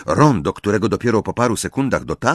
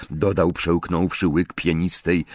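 ordinary delivery, nothing marked

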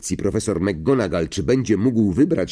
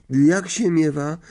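timid, higher-pitched question; nieśmiałe pytanie; 6 semitones higher than usual. A narrator's reading. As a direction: ask it a little shyly. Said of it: "zapytała nieśmiało Hermiona". (nieśmiało)

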